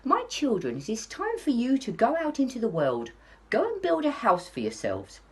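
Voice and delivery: a gentle hint of a caring mother; mother wolf. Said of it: "the mother called ... and said".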